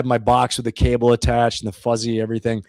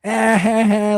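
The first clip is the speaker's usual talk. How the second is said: mocking laugh; 8 semitones higher, 3 dB louder, much slower than usual. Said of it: "My brother's like, ... laughing at me".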